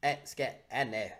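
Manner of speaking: low, soft, knowing cartoon voice; sinister and quiet, letting him know you're onto him; Cartman. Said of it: "And Cartman's like".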